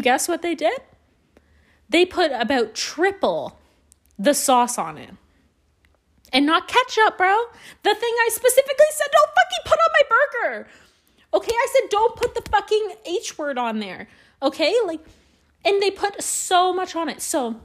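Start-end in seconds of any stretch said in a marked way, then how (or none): 6.3-17.2 furious retelling of the botched burger order; outraged rant. Real, not acted. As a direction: vent like the order was an insult. Ranting about the ruined burger.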